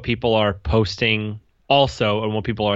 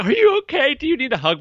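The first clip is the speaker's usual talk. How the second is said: much higher, sweet pretend comforting; mock concern. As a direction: coo it like soothing a stranger. Imagined comforting of crying stranger.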